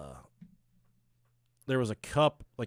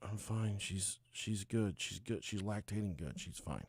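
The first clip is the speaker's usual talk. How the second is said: subdued reply; 4 semitones lower, 10 dB quieter than usual. Retold or imitated. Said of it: "And I said".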